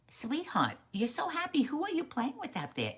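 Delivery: high, lilting mother voice; fond mother. Acted. Little Frog's mother asks about the new friend, fond and high. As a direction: coo a question to your child.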